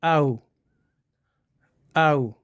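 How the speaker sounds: "आउ" gliding from schwa into u; diphthong demo. Shown as a demonstration. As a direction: slide from a short uh into oo in "आउ". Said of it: "इकट्ठा जब पढ़ेंगे".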